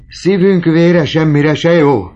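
high, loud, slow wail of despair; despairing outburst. A narrator's reading. Broken old father wails in despair, high, loud and slow. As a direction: let despair burst out. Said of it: "tört ki a kétségbeesés".

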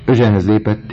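plain reading voice

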